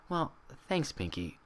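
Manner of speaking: soft, tired thanks; barely manage a smile in the voice; weak smile. Acted. Twilight thanking Pinkie with a weak smile, soft. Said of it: "managing a weak smile".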